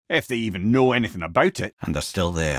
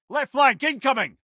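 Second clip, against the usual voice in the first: high, raised call; combat callout; 12 semitones higher than usual. Acted. Head warns the fighter of enemies on the left, raised high call. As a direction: call the flank like a lookout.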